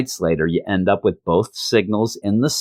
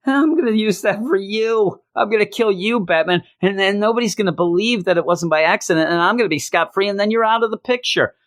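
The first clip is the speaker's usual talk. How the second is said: Deadshot gloating, pitched up high; hammy supervillain threat to Batman; mock villain voice; 10 semitones higher than usual. Deadshot gloats at Batman about killing him, pitched-up mock villain. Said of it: "he says basically to Batman".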